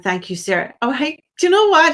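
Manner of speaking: ordinary delivery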